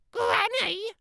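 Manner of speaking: put-on a sweet, self-admiring child's voice; vain little girl. Little Red voiced by narrator.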